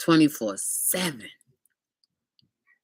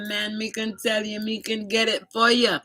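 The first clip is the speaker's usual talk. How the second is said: higher, light Jamaican patois offer; Jamaican accent; 6 semitones higher than usual. Retold or imitated. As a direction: sell it in a mild Jamaican accent. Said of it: "the Jamaican guy".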